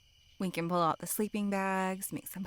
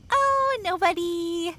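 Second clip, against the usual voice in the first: flustered cover-up; 11 semitones higher, 7 dB louder, slower than usual. Acted. Covering a slip about Frank.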